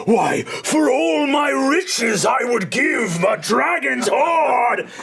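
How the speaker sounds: a huge dragon swelling from rumble to roar; glittering dragon. Acted. Illusory dragon speaks to gnome.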